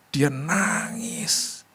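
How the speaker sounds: high, drawn-out, tearful-sounding telling; slow, high, overcome retelling; moved. Retold or imitated. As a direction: stretch it out high and slow, close to tears. Moved retelling of woman crying.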